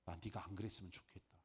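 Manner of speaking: soft whisper of the Lord; gentle whisper. Retold or imitated. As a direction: hush it like a tender voice from above. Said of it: "주님 말씀하실 거 아니에요".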